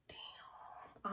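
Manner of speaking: voiceless whispered curse; whispered dread. Retold or imitated. Dreading mother's car at home.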